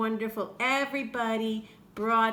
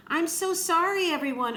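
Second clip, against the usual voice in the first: sighing apology; 7 semitones higher, 4 dB louder than usual. Acted. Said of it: "Omu sighed".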